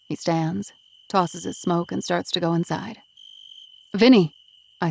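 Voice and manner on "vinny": loud call of his name to stop him. Acted. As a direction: call out to catch him before he goes in. Calling out to him as he leaves.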